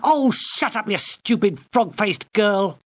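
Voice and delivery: high, snapping insult; furious girl. Acted. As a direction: lash out at her. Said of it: "whirled round on her".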